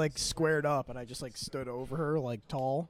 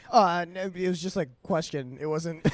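higher, louder mock protest; retold backpedalling; 4 semitones higher, 7 dB louder than usual. Coworker takes back her threat, mock flustered. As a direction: imitate someone flustered and backing down.

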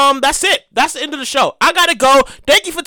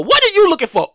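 ordinary delivery

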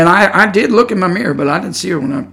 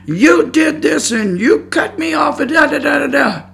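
mock yelling; 10 semitones higher than usual. Preacher imitating the woman yelling at him, mock yell. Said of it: "she starts yelling at me".

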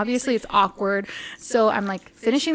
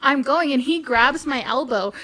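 usual speaking voice

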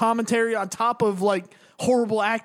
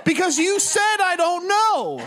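drawn-out, higher-pitched comic outrage; hammed-up exasperation, stretching the key words; exasperated retort; 6 semitones higher, slower than usual. Answering back to the movie's love interest, exasperated.

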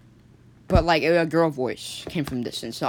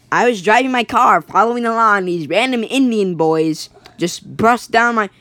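higher and louder, blurting it out; loud excited telling; 5 semitones higher, 6 dB louder than usual. Acted. Jonathan yells out his car story, high and loud. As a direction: shout the story out at the guys.

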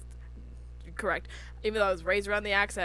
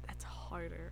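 whispered aside; 6 semitones lower, 18 dB quieter, slower than usual. Real, not acted. Whispered aside about English.

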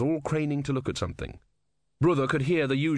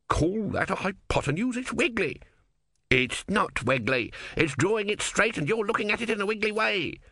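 high, indignant squabble; high-pitched petulant bickering; bickering philosophers; 10 semitones higher than usual. Acted. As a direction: argue a petty point. Two philosophers argue over the line.

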